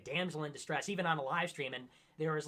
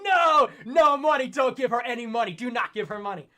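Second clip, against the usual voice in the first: imitating his screaming at chat to stop donating; mock screaming; 6 semitones higher, 10 dB louder than usual. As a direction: yell no at the chat, winding down. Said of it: "I'm in chat screaming".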